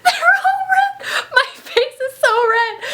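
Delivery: very high, slow, laughing squeal. Real, not acted. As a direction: squeal it through giggles.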